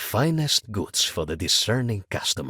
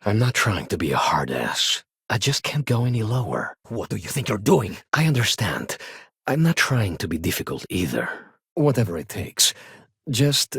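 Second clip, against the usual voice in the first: ordinary talk, nothing marked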